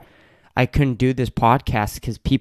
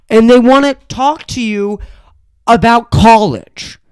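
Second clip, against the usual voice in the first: high, loud, indignant tirade; higher and louder exasperated complaining; heated rant; 11 semitones higher, 4 dB louder than usual. Real, not acted. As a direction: keep venting, raised and fired up. Heated rant about guests.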